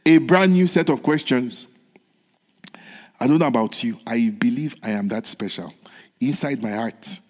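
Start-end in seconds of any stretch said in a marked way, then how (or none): none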